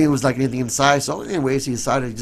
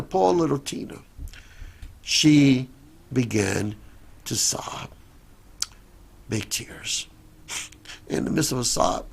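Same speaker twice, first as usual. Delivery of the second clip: usual speaking voice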